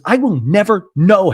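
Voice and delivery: NEVER and KNOW punched high and loud; imitated retort, hitting "never" and "know" hard; emphatic retort. Host replays housewife answering her friend, loud high stress on "never know".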